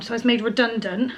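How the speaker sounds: normal talking voice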